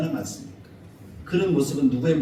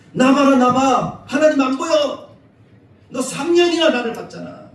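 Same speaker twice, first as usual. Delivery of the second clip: much higher, louder, slow exasperated rebuke; preacher imagines rebuking Philip, high and loud; scolding; 11 semitones higher, 10 dB louder, slower than usual. Pretend. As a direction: drag it out as if fed up with Philip. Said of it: "그렇게 야단을 쳤을 것 같아요".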